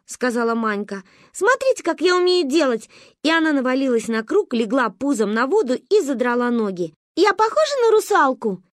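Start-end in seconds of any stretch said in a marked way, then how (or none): 1.3-2.9 high excited call; showing off. A narrator's reading. Girl shows friends her trick, high and excited. 7.2-8.6 excited question. A narrator's reading. Girl asks, showing off.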